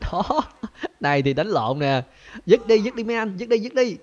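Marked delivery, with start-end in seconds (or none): 2.4-4.0 high, loud shout to break it up; loud mock referee separating the fishermen. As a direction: yell at the fighters like a mock referee. Refereeing a fistfight.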